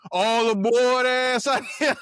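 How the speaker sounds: mock heckle rising in pitch; mocking roast. Classmates clowning his fake sailor clothes, retold as a mocking roast.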